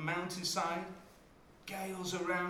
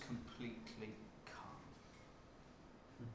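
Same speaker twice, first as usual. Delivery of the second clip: hushed; 5 semitones lower, 15 dB quieter, much slower than usual. Acted. Describing the calm after storm.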